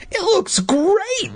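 put-on very high, drawn-out child's squeal; insistent. A narrator's reading. Boy insisting on the park, squealing. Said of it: "Luke insisted".

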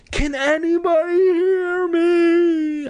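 high, slow, loud shriek. Acted. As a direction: shriek it upward in panic. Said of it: "I shrieked".

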